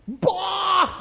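high, loud mock scoff; scoffing bah. Mourners laughing at Jesus, loud scoff. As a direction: scoff at him.

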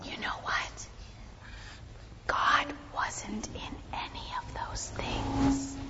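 slow, very soft whisper. Shown as a demonstration. Pastor telling children where God wasn't, slowly whispered. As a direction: hush right down to reveal a secret. Hushing children to reveal the point.

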